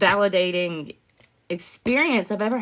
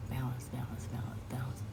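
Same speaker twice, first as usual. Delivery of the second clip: whispered, very soft, to steady herself; calming whisper; 7 semitones lower, 15 dB quieter than usual. Real, not acted. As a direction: breathe the word out like a mantra.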